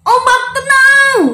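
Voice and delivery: high, loud, drawn-out order; slow ringing call; commanding. Acted. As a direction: command the waves with a long shout. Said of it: "Dia suruh ombaknya tenang".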